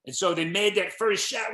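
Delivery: normal talking voice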